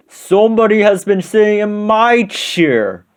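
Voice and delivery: high-pitched, booming big bear; great big voice. A narrator's reading. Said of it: "in his great big voice".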